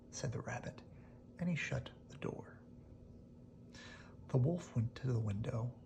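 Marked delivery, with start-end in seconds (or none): none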